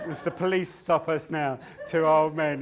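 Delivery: loud laughing; mock worried old men, laughing; laughing thought. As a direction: laugh at the absurdity. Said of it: "we're thinking".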